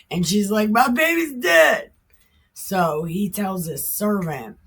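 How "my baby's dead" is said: high, loud cry of grief; anguished yell. Retold or imitated. Mother crying out to Elisha that her son died, loud grief. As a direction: shout the loss at him.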